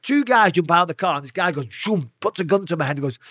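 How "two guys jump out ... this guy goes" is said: ordinary delivery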